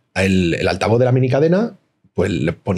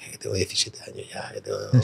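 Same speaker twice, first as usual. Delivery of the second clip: mumbled protest; 4 semitones lower, 11 dB quieter than usual. Retold or imitated. Teen protests to helpful lady.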